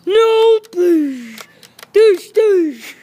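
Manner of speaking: loud yelp, then punchy shot noises; imitated gunfire. Soldier fighting in a pretend battle, loud mouth-made gunfire. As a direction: shout and fire the play gun.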